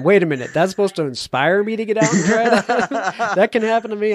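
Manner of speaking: hammed-up incredulity with a laugh; mock incredulous. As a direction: play a doubtful listener, climbing into laughter. Voices listeners' imagined incredulous objection.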